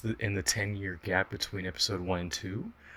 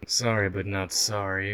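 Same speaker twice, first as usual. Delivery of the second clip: ordinary delivery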